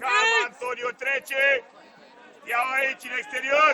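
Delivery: loud run of calls to a player; loud shouting. Real, not acted. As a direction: keep yelling the directions. Coach yelling instructions.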